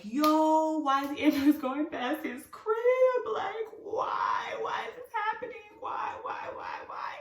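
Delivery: high, drawn-out whiny cry; panicked wail. Retold or imitated. Watching the ambulance pass his house, panicked wail. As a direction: wail it in mock despair, breaking into whispery whys.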